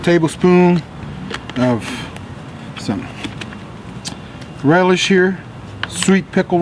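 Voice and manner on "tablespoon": normal talking voice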